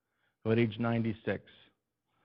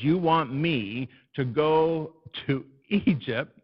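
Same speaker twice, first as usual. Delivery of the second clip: building to loud and higher, slow; exasperated Moses; 4 semitones higher, 7 dB louder than usual. Retold or imitated. Moses questioning God's mission, building loud exasperation. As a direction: pile up the objections in disbelief. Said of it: "and he's saying".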